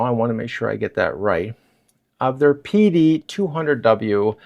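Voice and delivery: normal talking voice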